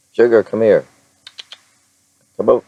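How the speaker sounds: loud, high call to the dog. Real, not acted. Owner calling her little dog over, loud and high.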